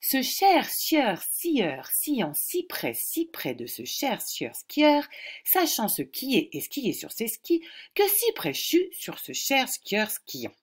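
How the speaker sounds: slow, syllable by syllable; slow careful reading. Shown as a demonstration. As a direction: read it slowly and carefully. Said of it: "je vous le lis une première fois tout doucement". (lecture lente et articulée)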